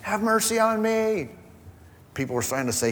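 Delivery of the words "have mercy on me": high, slow pleading call; crying out. Retold or imitated. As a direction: stretch the cry high and slow, begging for mercy. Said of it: "He's crying out".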